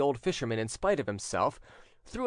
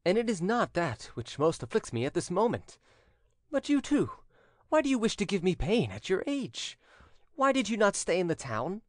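higher-pitched, pained reproach; tender reproach; 8 semitones higher than usual. A narrator's reading. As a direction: plead with your father in reproach. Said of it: "in a tone of tender reproach".

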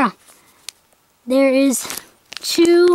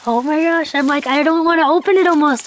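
normal talking voice

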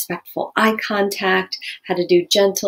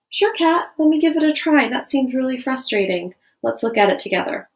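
calm teacher reply; 6 semitones higher than usual. Shown as a demonstration. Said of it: "what a talking tone of voice might look like".

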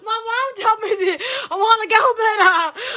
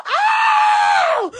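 long high-pitched holler; sustained squealing cry; 13 semitones higher, 4 dB louder than usual. Real, not acted. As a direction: hold a high, excited "oh". Squealing at silly effects.

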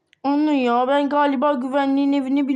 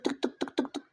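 soft voiceless tik tik tik, knuckles on a door; mouthed knocks on the school door; knocking sound; 11 dB quieter than usual. Acted. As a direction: tap it out under your breath like knocking. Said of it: "Vurayım kapıya".

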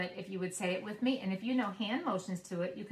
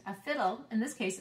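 ordinary talk, nothing marked

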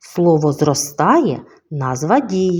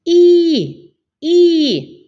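very high, loud squeal of the vowel І; pony's call; 11 semitones higher, 4 dB louder than usual. Shown as a demonstration. Teacher demonstrating the pony's sound, high squeal. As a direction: squeal it like the little horse. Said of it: "давайте разом вимовимо звук конячки".

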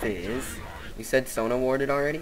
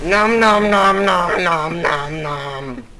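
chomping monster; 3 semitones higher, 6 dB louder, slower than usual. Pretend. Cho'Gath eating, chomping noises.

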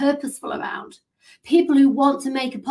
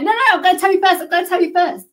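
higher, louder, fast insistent; can't wait to tell; eager; 8 semitones higher, 6 dB louder, much faster than usual. Retold or imitated. Friend insisting on telling her story first, eager and high. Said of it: "You're like".

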